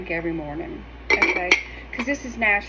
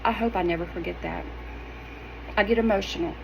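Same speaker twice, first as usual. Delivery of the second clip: ordinary talk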